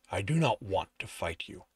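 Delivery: low creature growl; low monster growl. Acted. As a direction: growl the words like a wary beast. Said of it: "it growls".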